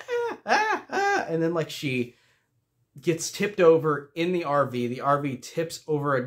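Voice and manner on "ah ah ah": very high, thin mock scream in short bursts. Reviewer imitates the actress screaming in the camper: high mock shrieks. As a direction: imitate a campy horror victim shrieking. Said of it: "She goes".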